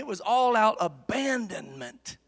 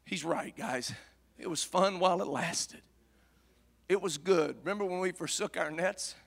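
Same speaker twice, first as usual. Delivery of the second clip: low, soft, dejected reminiscing; subdued voice of defeated disciples; wistful resignation; 4 semitones lower, 8 dB quieter than usual. Acted. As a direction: quietly look back on what's over. Disciples look back in defeat.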